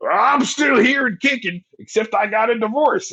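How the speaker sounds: high, loud, chirpy mock Tommy voice; mock cheerful Tommy. Friend mocks Tommy returning cheerfully, high and loud. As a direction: ham up a jolly survivor.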